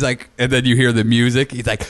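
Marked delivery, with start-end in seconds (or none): none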